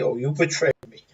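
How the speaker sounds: whispered, hurt pout. Acted. Zev pouts sidelong at Glory, whispering. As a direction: breathe it out like a sulking betrayed friend. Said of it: "pouts as if to say".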